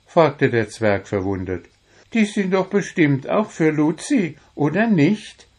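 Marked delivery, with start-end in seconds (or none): 2.1-5.5 much higher, playful goblin friend; Wuschel, teasing. A narrator's reading. Wuschel asks Puh back about the roses, high and teasing. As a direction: ask it back with a grin.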